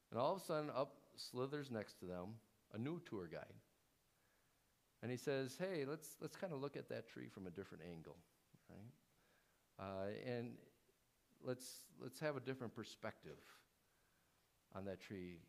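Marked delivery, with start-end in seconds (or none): 5.6-15.4 soft, sly serpent voice, a little fast; villainous whisper. Acted. Serpent coaxing Eve at the tree, softly sly. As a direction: coax her quietly, like a tempter. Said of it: "he whispers, this villainous whisper".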